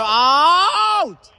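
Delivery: high loud call; excited shout. Real, not acted. Commentator calling the ball out, loud excited shout. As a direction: snap out the call.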